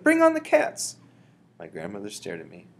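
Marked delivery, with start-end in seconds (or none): 0.0-1.0 high, loud rallying cry; high-pitched excited shout. A narrator's reading. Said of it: "I shouted".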